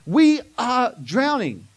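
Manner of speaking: loud, slow, frightened plea; panicked cry. Retold or imitated. Disciples crying out to Jesus in the storm, slow and frightened. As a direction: cry out as if the boat is sinking. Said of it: "are so worried about this".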